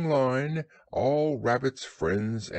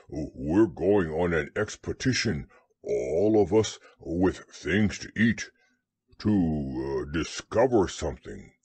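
low bear with mild excitement; eager but fumbling, in a deep voice; excited Pooh; 7 semitones lower than usual. A narrator's reading. Pooh tells Piglet the news, mildly excited. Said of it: "said Pooh excitedly".

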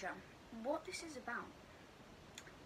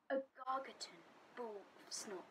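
plain reading voice

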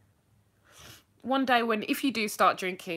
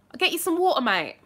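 high, loud London accent; 8 semitones higher, 5 dB louder than usual. Shown as a demonstration. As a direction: shout it matily in London speech. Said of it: "That was, like, my London accent".

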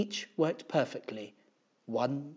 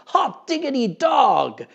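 high, loud, drawn-out cheer; slow, jubilant high holler; excited shout; 12 semitones higher, 14 dB louder, slower than usual. A narrator's reading. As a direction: holler it in celebration. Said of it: "shouted Damien".

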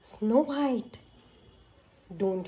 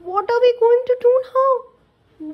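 horrified; 10 dB louder than usual. Acted. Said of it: "they were horrified".

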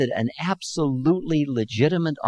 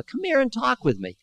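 put-on much higher, inviting elderly voice; an old man coaxing a drunk to sit and talk; easy old man; 12 semitones higher than usual. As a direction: draw him in, unhurried and friendly. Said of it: "the old man said in easy vernacular".

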